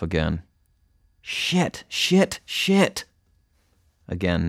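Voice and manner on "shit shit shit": high, sharp run of curses; rapid-fire high-pitched frustration; frustrated cursing. A narrator's reading. Girl failing to find her vein, high frustrated cursing.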